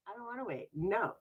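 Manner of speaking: high, soft sulky refusal; mock bratty refusal. Refusing God's wait, mock sulk. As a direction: lightly sulk like a child. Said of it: "it turns into sarcasm".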